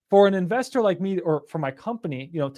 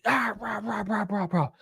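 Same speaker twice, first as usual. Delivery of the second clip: angry rah noises; 3 semitones higher, 7 dB quieter than usual. Retold or imitated. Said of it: "viscerally angry".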